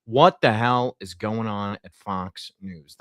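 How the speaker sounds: drawn out, word by word; slow right down to hammer the question home; slow emphatic repeat. Real, not acted.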